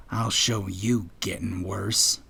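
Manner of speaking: low, slow, whispery grumble; grumbling. A narrator's reading. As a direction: grumble it slowly under your breath. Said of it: "Sprint grumbled".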